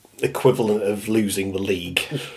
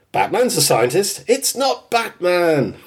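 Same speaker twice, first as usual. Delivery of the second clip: high, slow insistence; mock stubborn Homer; quoting Homer; 6 semitones higher than usual. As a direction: drag out "it's not Batman" up high. Homer quote.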